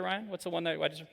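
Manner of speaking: ordinary talk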